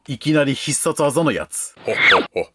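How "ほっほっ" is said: put-on creaky wise old man. Anniversary greeting in a put-on voice.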